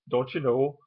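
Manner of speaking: Scandinavian-tinged Minnesota lilt; Minnesota accent. Shown as a demonstration. Vlogger imitating Scandinavian-descended locals, Minnesota accent. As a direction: put on a Minnesota "yeah". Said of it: "They say "yeah" a lot".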